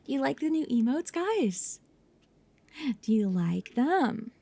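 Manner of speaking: ordinary talk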